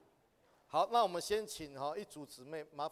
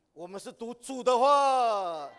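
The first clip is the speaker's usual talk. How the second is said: loud and high, slower, stressing 主的话 at full force; emphatic preaching; 8 semitones higher, 15 dB louder, slower than usual. Real, not acted. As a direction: proclaim 主的话 loudly, drawing it out. Preacher stresses reading Lord's word. (大声高昂、放慢，加重"主的话")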